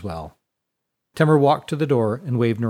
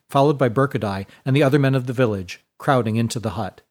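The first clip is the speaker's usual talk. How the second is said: ordinary delivery, nothing marked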